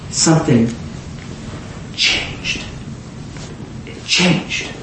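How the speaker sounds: breathy whispered repeat, voice dropped away; hushed. Real, not acted. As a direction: let the word fall to a whisper. Hushed repeat of key word.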